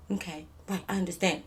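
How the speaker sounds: soft meek mock compliance. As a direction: mumble like a scolded child. Mocking friend meekly obeying daddy.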